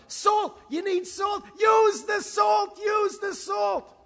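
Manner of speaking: high, loud, panicky barking of orders; frantic back-seat cook. Acted. Husband bursts in, frantic orders.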